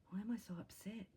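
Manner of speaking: a hushed, breathy question to herself; soft retold whisper of self-doubt.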